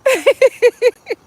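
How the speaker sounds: a real high laugh breaking out; high burst of laughter. Real, not acted. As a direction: burst out in a high, loud giggle. Laughing at her own plea.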